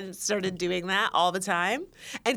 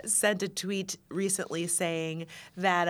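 usual speaking voice

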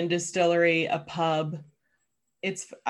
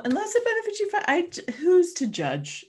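mock innocent; 12 semitones higher, 3 dB louder than usual. Mock justifying cults.

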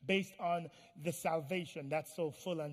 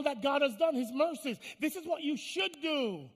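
raised pitch, urgent pulpit delivery; insistent preaching; 7 semitones higher, 5 dB louder than usual. Acted. Preacher urges congregation to present their bodies, in insistent high voice. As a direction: drive the text home, voice lifted.

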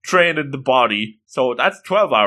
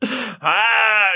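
high-pitched, restrained comic scream; mock shocked wail; 8 semitones higher, much slower than usual. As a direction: scream in playful horror without much volume. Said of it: "He's screaming".

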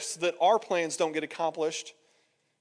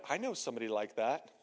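low, soft, as if thinking to oneself; private thought; 9 semitones lower, 9 dB quieter than usual. Acted. Listener privately thinking of someone else, low and quiet. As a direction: drop low and quiet for an inner remark.

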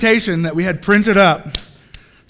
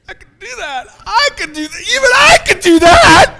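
building eager excitement; 11 semitones higher, 7 dB louder than usual. Acted. Shy students realizing they can invite, building excitement. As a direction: start small and high, burst into a shout.

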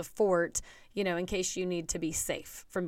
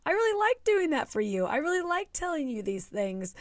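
mock offended mom; 10 semitones higher than usual. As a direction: play up hurt feelings, much higher. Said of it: "I'm like".

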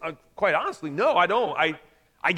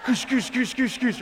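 mock giant grunts; 4 semitones higher than usual. Giants' talk mimicked as noise.

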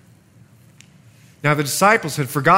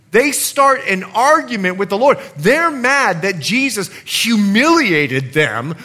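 loud, rising sermon delivery, raised voice; preaching at full force; 5 semitones higher, 6 dB louder than usual. Acted. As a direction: drive the point home to the congregation.

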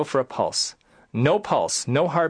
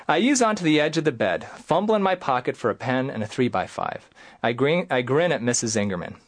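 ordinary talk

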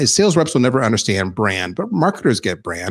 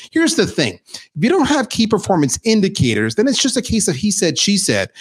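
normal talking voice

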